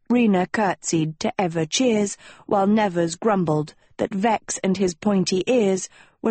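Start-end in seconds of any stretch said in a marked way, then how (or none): none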